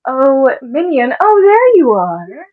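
high, loud, drawn-out call. Acted. Villain calling a lost minion, high drawn-out call.

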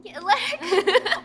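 slow, laughing meme echo; laughing hype. Acted. Echoing the meme phrase laughing.

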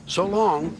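high, bright-faced goodbye with a smile; putting on bravery. A narrator's reading. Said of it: "smiled Oliver bravely".

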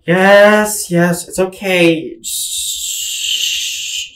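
slow, gentle hushing that ends in a whispered shh; calm the kid down like a mother; soothing mom. Retold or imitated. Mom soothing her injured child.

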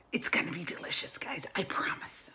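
soft whispered promise to viewers. Real, not acted. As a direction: lean in and whisper it.

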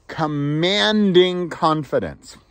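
slow dramatic title. Acted.

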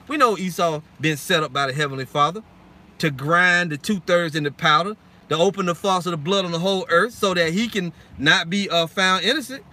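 ordinary delivery

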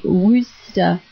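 Worcester pronounced "WOOS-ter", two syllables; corrected pronunciation. Shown as a demonstration. Repeating the board's spelling for listeners, Worcester said as "WOOS-ter". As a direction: show that Worcester starts like "wood", then "-ster". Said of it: "You say it like".

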